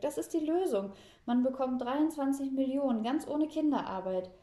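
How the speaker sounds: high, loud, enthusiastic pitch; excited. A narrator's reading. Girl explains the jackpot to grandpa, excited. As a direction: sell the brilliant plan, thrilled. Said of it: "sagt Fräulein Schmalzbrot aufgeregt".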